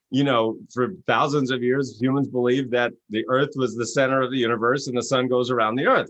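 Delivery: ordinary talk, nothing marked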